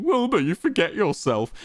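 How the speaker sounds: high-pitched mocking imitation. A narrator's reading. Wilbur throwing Quackity's words back, mocking his intonation. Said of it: "mocking Quackity's intonation".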